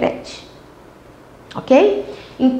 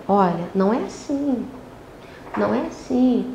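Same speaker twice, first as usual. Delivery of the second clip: softer, gentle parent voice; calm soft correction; 2 semitones lower, 8 dB quieter than usual. Shown as a demonstration. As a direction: kneel down and say it softly. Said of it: "olhar nos olhos da criança, não com autoridade". (correção calma e suave)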